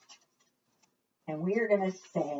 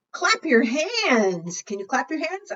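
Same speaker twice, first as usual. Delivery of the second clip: excited invitation; 7 semitones higher, 4 dB louder than usual. Acted. Leading kids in clapping.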